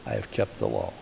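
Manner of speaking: low, quiet self-assurance; unflinching gall. Retold or imitated. Man answering Jesus after the commandments, with unflinching gall. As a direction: state it with understated hubris. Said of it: "the unmitigated gall".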